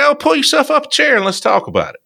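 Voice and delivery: hammed-up porch welcome; Southern host welcome. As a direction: invite them to sit a spell. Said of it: "It's like".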